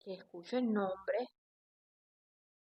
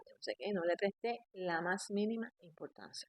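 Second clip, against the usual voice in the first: ordinary delivery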